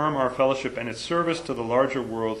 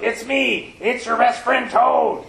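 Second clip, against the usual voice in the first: Toad calling out; 8 semitones higher, 5 dB louder, slower than usual. Acted. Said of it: "he shouted".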